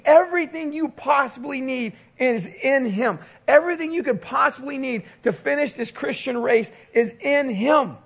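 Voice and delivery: usual speaking voice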